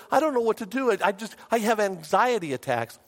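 high, flustered complaint; retold panic. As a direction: imitate an anxious caller at a loss. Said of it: "she was just panicked".

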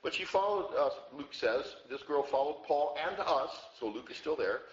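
ordinary talk

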